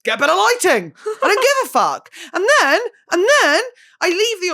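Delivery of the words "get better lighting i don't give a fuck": mock dismissive outburst. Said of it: "they were like".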